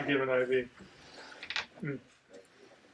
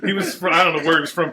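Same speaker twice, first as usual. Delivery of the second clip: ordinary delivery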